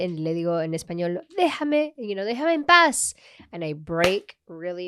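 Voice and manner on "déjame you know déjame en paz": high, louder Spanish leave me alone; exasperated plea. Retold or imitated. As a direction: beg him to drop it, fed up. Retold Spanish plea to boyfriend.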